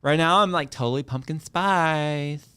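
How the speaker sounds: put-on slow, drawn-out Valley Girl accent. Shown as a demonstration. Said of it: "I had to do that in my Valley Girl accent".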